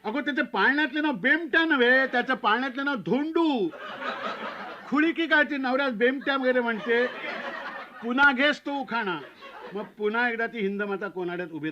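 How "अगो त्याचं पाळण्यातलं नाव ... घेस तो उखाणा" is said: put-on high, scolding old uncle; snapping uncle. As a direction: snap at the bride to correct the name. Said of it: "खेकटला".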